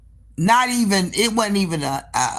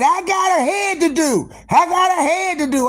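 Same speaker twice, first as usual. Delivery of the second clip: hollering; 12 semitones higher than usual. Retold or imitated. Stuck in the cop car, hollering to get back to the shop. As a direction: yell it up high, desperate to get back. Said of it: "I'm hollering like that".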